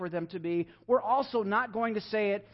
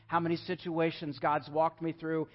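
normal talking voice

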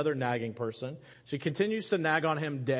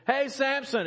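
calling out; 10 semitones higher, 3 dB louder than usual. Acted. Delilah calling Samson awake.

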